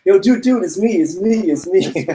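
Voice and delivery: high-pitched, hurried plea, retold; acted-out frantic "calm down" call; urgent reassurance. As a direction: plead to be recognized, voice up high. Retelling his panicked reassurance to the man.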